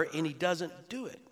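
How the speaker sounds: ordinary delivery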